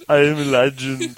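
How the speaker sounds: echoed mock Jamaican accent. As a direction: repeat it in a put-on Jamaican accent. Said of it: "That's my Jamaican accent".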